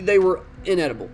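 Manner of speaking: ordinary talk, nothing marked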